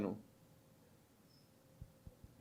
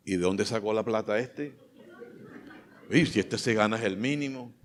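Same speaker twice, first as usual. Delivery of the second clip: quietly suspicious mock gossip; grumbling suspicion, kept under the voice; envious gossip; 4 dB quieter than usual. Acts out envious gossip about car.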